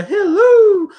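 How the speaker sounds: high, loud mock film greeting; Mrs. Doubtfire. Host imitating the famous movie greeting, high nanny voice. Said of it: "when he does the line".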